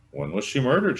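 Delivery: high, loud surprised question; mother's voice. Acted. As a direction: ask as if surprised. Mother feigns ignorance.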